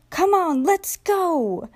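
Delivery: high, eager rallying call; whistling engine voice. A narrator's reading. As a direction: call out like a little train whistle. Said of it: "he whistled".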